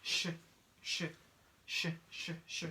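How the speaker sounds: a breathy sh hiss held out; sh sound. Shown as a demonstration. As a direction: model the sh sound on its own. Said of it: "it's pronounced like sh".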